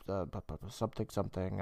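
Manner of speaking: low, soft placeholder mumbling; mumbled filler. Retold or imitated. Narrator fudges the forgotten voiceover line, mumbling quietly. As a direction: trail into vague mumbled syllables. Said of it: "I don't know what he said".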